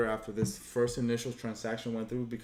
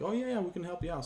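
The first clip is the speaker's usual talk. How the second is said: cheery store clerk; 8 semitones higher, faster than usual. Retold or imitated. Store clerk voiced in story.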